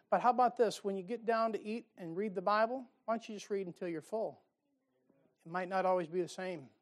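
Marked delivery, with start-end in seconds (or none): none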